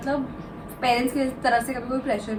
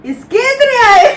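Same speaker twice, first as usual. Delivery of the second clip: much higher and louder, stunned; shocked disbelief; 12 semitones higher, 14 dB louder, slower than usual. Retold or imitated. Mom's friends mocking astonishment at her marks. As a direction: gasp it out in disbelief.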